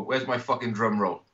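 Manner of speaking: ordinary talk, nothing marked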